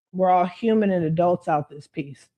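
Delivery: ordinary talk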